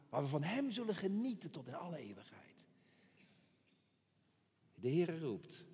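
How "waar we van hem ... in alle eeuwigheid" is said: fast hushed whisper; whispered promise of eternity. Real, not acted. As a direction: drop to an awed whisper. The preacher whispers about eternal joy.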